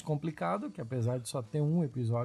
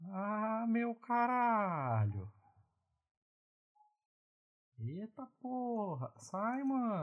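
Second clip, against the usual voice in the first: startled; 7 semitones higher, 3 dB quieter, slower than usual. Real, not acted. Startled by huge pop-up.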